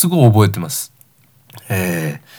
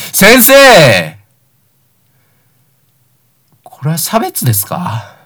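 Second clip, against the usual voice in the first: shouting accusation, climbing higher and louder; mock outraged schoolboy yelling; retold shouting; 5 semitones higher, 3 dB louder than usual. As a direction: yell at the teacher that it's unfair. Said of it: "クラス全員の前で怒鳴り始めたわけ".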